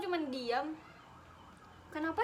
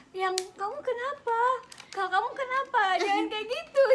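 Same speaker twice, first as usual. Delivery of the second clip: higher, louder imitation of a fussing friend; mock worried friend; 7 semitones higher, 6 dB louder than usual. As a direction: play the clingy friend begging, hammed up. Imitates friend pleading, mocking.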